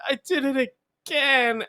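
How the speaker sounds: high, wailing mock sob of a kid; mock crying. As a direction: fake a crying child's whine. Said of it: "I was crying even harder".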